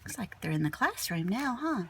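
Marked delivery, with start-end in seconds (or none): none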